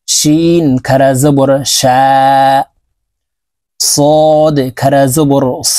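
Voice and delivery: each letter drawn long, chanted; slow drill recitation. Shown as a demonstration. Teacher drilling khara jabar letters with viewers, slowly. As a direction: stretch every vowel a full alif. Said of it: "তো আমরা এইভাবে পড়ব".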